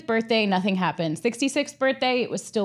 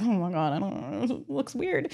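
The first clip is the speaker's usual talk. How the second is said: hushed doubt; 8 dB quieter than usual. Retold or imitated. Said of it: "I was like".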